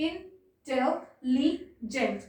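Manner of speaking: "intelligent" split as in, tel, li, gent with pauses; "intelligent" in four drawn-out, detached beats; syllable by syllable. Shown as a demonstration. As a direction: break "intelligent" slowly into four separate syllables. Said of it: "divide হচ্ছে".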